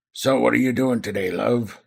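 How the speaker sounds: rough-voiced, chatty innkeeper; gravelly voice. Landlady asking her regular about his day, gravelly voice. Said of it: "I shouldn't have done a gravelly voice".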